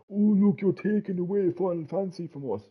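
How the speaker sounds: small, high, soft mocking voice; mock complainer. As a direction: mimic aggrieved fans in a tiny high voice. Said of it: "people need to realise that and stop".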